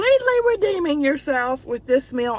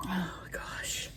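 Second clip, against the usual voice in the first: hushed oh gosh under breath; whispered; 3 semitones lower, 15 dB quieter than usual. Real, not acted. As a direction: whisper it to yourself. Bracing for messy bite.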